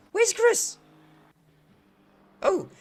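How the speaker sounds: puzzled sudden question; startled confused question; confused surprise. Real, not acted. Confused looking for driver.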